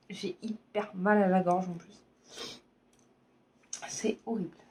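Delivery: chuchoté, voix éteinte par le mal de gorge; voix cassée. Real, not acted. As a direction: presque sans voix, gorge irritée. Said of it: "vraiment désolée pour ma voix". (murmure enroué d'une gorge douloureuse)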